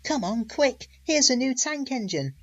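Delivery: put-on high, loud, eager kids' shout; excited shouting boys. Little boys shout at Gordon's arrival, high and loud. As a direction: yell it like boys spotting a new engine. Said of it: "Some little boys shouted".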